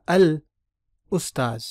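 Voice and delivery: "अल" stressed, then उस्ताज़ falling; teaching demo. Shown as a demonstration. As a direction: mark the article before उस्ताज़. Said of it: "मैं कहूँ उस्ताज़". (अल पर ज़ोर, फिर उस्ताज़)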